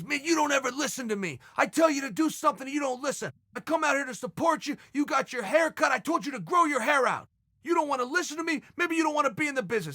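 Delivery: high, fast, indignant dressing-down; scolding rant. Retold or imitated. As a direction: chew him out in front of the table. Guy scolds him in front of everybody.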